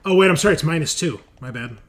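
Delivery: normal talking voice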